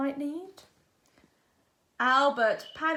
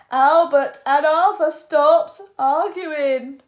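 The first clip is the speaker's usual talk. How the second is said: usual speaking voice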